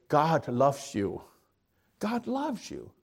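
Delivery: soft, slow imitation of an Irish brogue; a gentle Irish brogue, tenderly. Preacher imitating the TV angel in an Irish brogue. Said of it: "say it with this beautiful Irish brogue".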